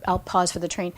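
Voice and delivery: ordinary talk, nothing marked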